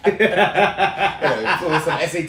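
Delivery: slow, laughing parent nag; mock strict parent. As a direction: drag it out like a nagging parent. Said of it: "parent list continued".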